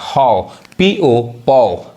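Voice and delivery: हॉ and पौ drawn out slowly with an open aw; patient phonics drill; slow spelled-out demo. Shown as a demonstration. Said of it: "यानी H O हॉ पढ़ाएगा".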